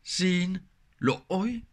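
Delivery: xin lỗi very slow, lỗi rising; slow Vietnamese demo. Shown as a demonstration. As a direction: say xin lỗi slowly, lifting lỗi. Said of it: "Donc, xin".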